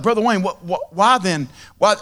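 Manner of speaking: imitating a confused listener; puzzled disbelief. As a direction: play the baffled congregation asking why. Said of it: "You're like".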